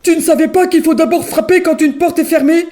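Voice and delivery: a hint of a big bear telling off a child; big bear scolding. A narrator's reading. The big bear scolds the fleeing girl, gruff. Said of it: "de sa grosse voix, lui crie".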